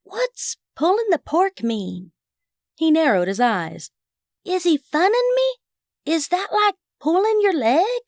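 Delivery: put-on high, puzzled child voice; high-pitched little boy, unsure if he's teased; confiding boy. A narrator's reading. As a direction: ask suspiciously in a high boy's voice. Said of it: "so only I could hear".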